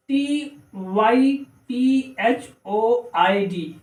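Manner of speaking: slow, letter by letter; spelling out. Shown as a demonstration. Teacher spelling typhoid for learners, slow letters. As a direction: dictate each letter separately.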